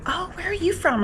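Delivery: high, bright tourist question; chirpy customer. Retold or imitated. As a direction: play a peppy tourist asking. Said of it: "she's like".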